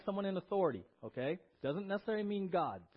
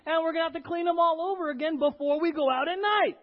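loud, high, indignant complaint building in force; exasperated protest; 8 semitones higher, 9 dB louder than usual. Acted. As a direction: argue back like a fed-up worker. [name] acted objecting to extra work.

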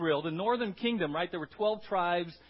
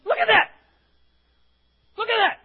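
loud, high excited cry; excited exclamation; 14 dB louder than usual. Retold or imitated. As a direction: point it out, all worked up. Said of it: "Daryl says to Betty".

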